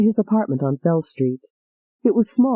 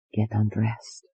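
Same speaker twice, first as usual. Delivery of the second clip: low, much softer whisper; 8 semitones lower, 7 dB quieter, slower than usual. Acted. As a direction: lover whispering an order to her, softer.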